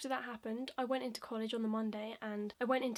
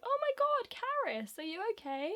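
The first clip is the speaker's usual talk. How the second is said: imitated concerned teacher; 11 semitones higher than usual. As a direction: exclaim high, then check on her.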